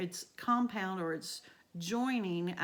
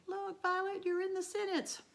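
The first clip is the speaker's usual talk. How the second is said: much higher, bright playful call to a student; playful teasing; 11 semitones higher than usual. Real, not acted. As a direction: tease the student whose name is in the sentence. Teacher teases student named Violet.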